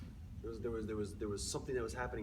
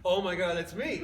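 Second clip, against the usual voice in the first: louder, higher, overwhelmed; reliving the first heartbeat; excited; 6 semitones higher, 10 dB louder than usual. Retold or imitated. Father at the ultrasound, excitedly overwhelmed.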